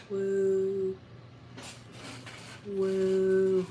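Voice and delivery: high, sustained "woo"; playful "woo". Pretend. Streamer playing with his shadow, high drawn-out woo. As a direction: hold a long high ghostly woo.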